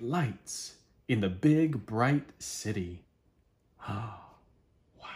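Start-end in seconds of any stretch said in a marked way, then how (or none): 3.9-5.1 hushed gasp then a soft wow; storyteller's hushed awe. Acted. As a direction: marvel quietly at the bear's fame. Reader marvels at the bear.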